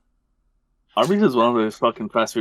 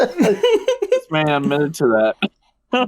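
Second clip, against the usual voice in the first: laughing; 7 semitones higher, much slower than usual. Real, not acted. Laughing at the friend's theft confession.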